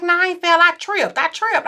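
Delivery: mock his stubborn denial, high; lightly voiced husband denying. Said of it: "He was like".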